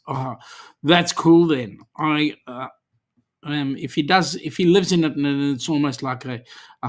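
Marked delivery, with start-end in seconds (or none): none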